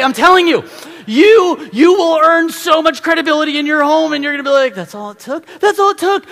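very high, loud, animated sermon; excited preaching. Acted. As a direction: build to a thrilled peak. Preaching at full force.

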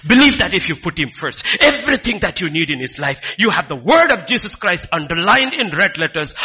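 loud, rising preacher's cry; fervent sermon delivery; preaching at full force. Acted. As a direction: preach it out to the congregation.

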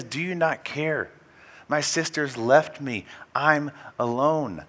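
anguished plea. Retold or imitated. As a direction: cry it out like a wounded sister. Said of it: "Listen again to what she says".

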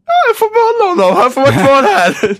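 high, loud burst of mock triumph; hammed-up glee. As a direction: squeal it through laughter, gleefully. Acts out delight at keeping him. (överdrivet förtjust, högt och gällt)

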